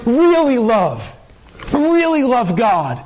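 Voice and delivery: "really" drawn out high and slow; stress on really. Shown as a demonstration. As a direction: lean into "really", slowly. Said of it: "In other words".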